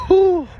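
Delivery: loud, high "whoo"; imitated taunting whoo. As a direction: hoot like the boys watching a friend get punked. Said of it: "they doing that one thing".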